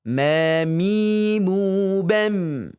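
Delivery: "Ma, mi, mu, bem" with closed lips and humming m; lip-closed mim chant; mim drill. Shown as a demonstration. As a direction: chant "bem" ending on a held hum. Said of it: "Kemudian huruf mim".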